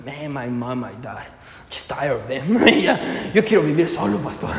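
low, hushed complaint under the breath; grumbling youngster. Acted. Young people complaining to the pastor about parents, low grumble. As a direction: play a fed-up youngster, low and quiet.

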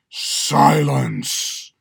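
loud hissed command through tight lips. A narrator's reading. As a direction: hiss the word at him. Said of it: "the chief judge hisses through tight lips".

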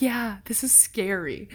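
whispered, hushed and scared. Retold or imitated. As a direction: breathe it out afraid. Whispered fear.

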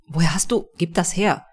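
urgent, narrated demand. A narrator's reading. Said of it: "fordere ich ihn auf".

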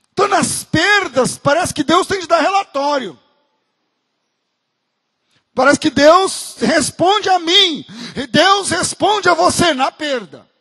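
loud, slow pulpit emphasis; preaching with rising force; fervent preaching. Acted. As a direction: hammer each phrase home. Preacher raising voice again.

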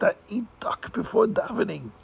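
a humble man unworthy to lead prayer; distraught chassid. Acted. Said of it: "he thinks to himself".

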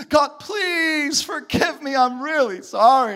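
high, drawn-out whiny pleading; mock whining prayer. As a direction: whine it slowly like a hammed-up beggar. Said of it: "some people sound like this".

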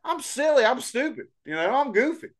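high goofy country voice; silly cowpoke. Pretend. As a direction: play a dopey ranch hand.

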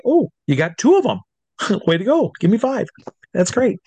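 put-on high, impressed voice for a small child; delighted praise. Showman congratulates four-year-old volunteer, delighted praise. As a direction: cheer on the little helper. Praising little girl in show.

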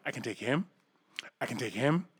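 quietly sizing him up, muttering to himself; muttered under breath. Retold or imitated. Passenger sizing up a big Texan, muttering quietly. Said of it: "I'm like".

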